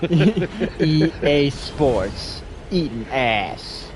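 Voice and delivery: slow, drawn-out jingle announcer; EA Sports announcer. Pretend. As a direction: stretch it out like a game intro.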